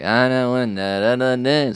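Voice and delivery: boring mumbled rap drone. Retold or imitated. Explaining how a rapper raps, boring-voice imitation. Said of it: "a very boring voice".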